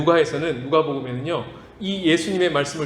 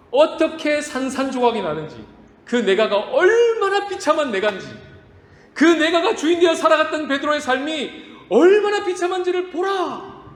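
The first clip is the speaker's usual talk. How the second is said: preaching at full force; 9 semitones higher than usual. Acted. Pastor drives home Peter's collapse, rising to a shout. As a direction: build to a shout on the wretched life.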